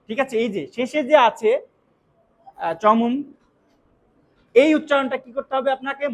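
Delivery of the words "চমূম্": চমুম ending in a clear m; final m. Shown as a demonstration. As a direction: close the lips on the last sound of চমুম. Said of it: "ময় উচ্চারণ করতে হবে". (চমুম-এর শেষে ম উচ্চারণ)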